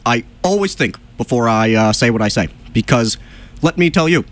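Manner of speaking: halting presidential cadence, repeating phrase openings; deliberate politician's stammer; Obama. Acted. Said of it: "if I were Obama".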